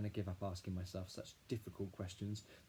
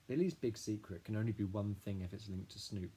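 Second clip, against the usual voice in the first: usual speaking voice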